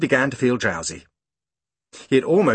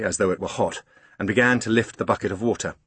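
plain reading voice

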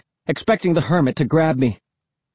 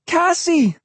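high-pitched cries for help; desperate, shrill calling; calling desperately; 14 semitones higher, slower than usual. A narrator's reading. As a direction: call out frantically, pitched very high. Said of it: "I called desperately".

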